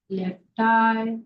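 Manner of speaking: normal talking voice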